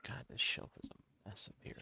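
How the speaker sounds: whispered grumble to himself; muttered whisper. Real, not acted. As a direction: mutter it under your breath. Grumbling at a messy shelf.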